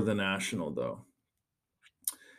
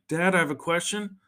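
put-on higher little kid asking; 7 semitones higher than usual. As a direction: sneak in with a child's voice. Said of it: "so bang".

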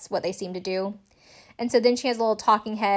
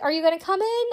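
calling out; 10 semitones higher, faster than usual. Retold or imitated. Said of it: "She's like".

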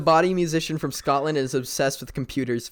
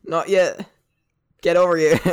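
louder put-on Southern come-on; Southern accent; 3 semitones higher, 5 dB louder than usual. Southern woman character pulling the man in, loud mock accent. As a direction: beckon loudly in mock Southern. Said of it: "become all so Southern".

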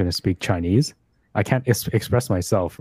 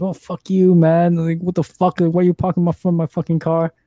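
angry neighbour; 6 semitones higher than usual. Retold or imitated. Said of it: "this guy's like".

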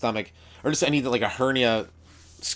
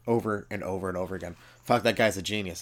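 ordinary delivery

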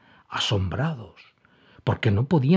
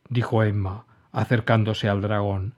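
ordinary delivery

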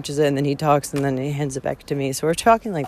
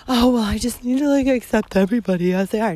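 higher-pitched mocking imitation of acceptance; mock niceness; 5 semitones higher, faster than usual. Hiker mocking the nice and loving concept, mock-sweet. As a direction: parody a too-accepting person, pitched up. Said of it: "concepts of being nice and loving".

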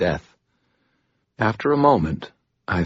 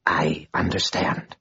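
slow, dry rasp, a bit softer; 3 dB quieter, slower than usual. Acted. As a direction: hiss the submission out. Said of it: "she rasped".

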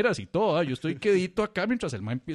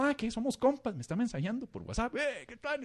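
excited; 9 semitones higher than usual. Retold or imitated.

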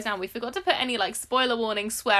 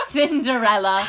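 laughing; 4 semitones higher, 9 dB louder, slower than usual. Real, not acted. Laughs at the mispronounced name.

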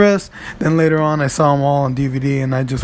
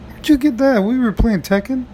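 mocking high question; 8 semitones higher than usual. Mock question to [name].

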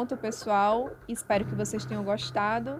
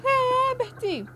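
very high, drawn-out loud shriek; high wailing mother's shout; shrieked cry; 14 semitones higher, 5 dB louder, much slower than usual. A narrator's reading. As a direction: shriek the name, stretching it long. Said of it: "ela gritou".

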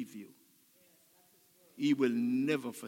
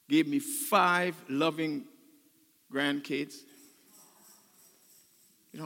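usual speaking voice

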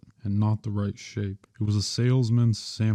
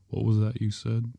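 usual speaking voice

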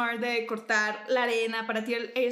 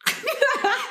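high burst of laughter; real high laugh; loud laugh; 13 semitones higher, 6 dB louder than usual. Real, not acted. Laughing at whisper demo.

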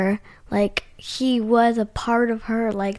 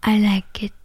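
ordinary delivery

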